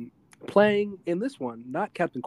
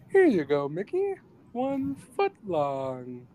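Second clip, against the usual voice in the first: squeaky, much higher Pee-wee impression; 4 semitones higher, slower than usual. Host retelling Pee-wee handing Mickey a file, squeaky. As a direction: chirp it like Pee-wee, slow at the end.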